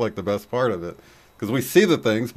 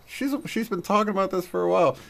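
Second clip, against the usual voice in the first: recalled thought; 11 semitones higher than usual. Retold or imitated. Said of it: "I'm like".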